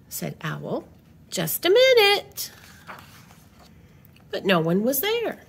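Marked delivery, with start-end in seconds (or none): 1.3-2.5 calling out. Acted. Owl calls to the door.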